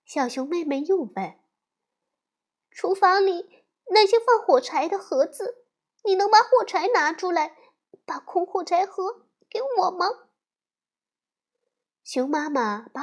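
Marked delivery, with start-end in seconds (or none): 2.7-10.1 put-on high, quick, eager child's request; a small girl asking mom for a favor; little girl bear. 小熊妹妹向妈妈要空火柴盒，急切高声.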